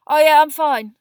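loud, high, flustered reply; high gabble. A narrator's reading. Neville reassures Hermione, gabbling unnaturally high. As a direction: insist too hard that you are fine. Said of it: "gabbled in the same unnaturally high voice".

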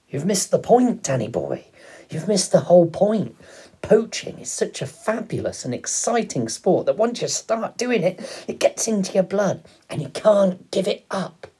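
high, loud, rushing with enthusiasm; excited father. A narrator's reading. Father telling Danny about the thrill of poaching, excited.

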